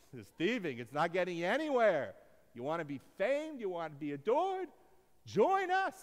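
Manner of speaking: hammed-up pitch building to a high, loud call; rousing recruiter. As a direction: work up to a ringing invitation.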